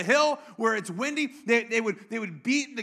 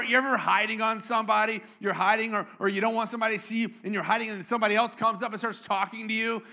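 normal talking voice

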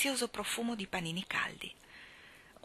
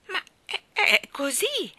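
high, halting stammer; 12 semitones higher, 7 dB louder, slower than usual. A narrator's reading. Michael stammering an answer to Mary Poppins. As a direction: trip over the first words. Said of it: "balbettò Michael".

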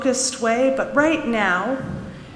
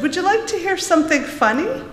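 mocking teacher; 11 semitones higher, 4 dB louder than usual. A narrator's reading. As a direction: tease him in front of the class, pitched high. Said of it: "with a grin on her face".

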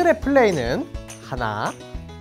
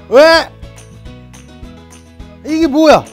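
high puzzled outburst; surprised; 6 semitones higher, 8 dB louder than usual. Real, not acted. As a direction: react like it's a shock. Surprised at the box contents. (깜짝 놀란 말투)

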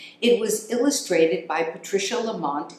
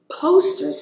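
much higher, louder little-critter voice; high hamster voice; 10 semitones higher, 5 dB louder than usual. Acted. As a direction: announce it like an eager tiny hamster. Hamster Max reads sign.

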